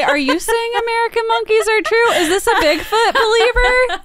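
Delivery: high, laughing disbelief. Real, not acted. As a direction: squeal the question through laughter.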